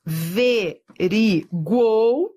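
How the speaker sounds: AVERIGUOU slowly, a-ve-ri-guou; syllable by syllable. Shown as a demonstration. Teacher showing the triphthong in averiguou, syllable by syllable. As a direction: break averiguou into spaced syllables.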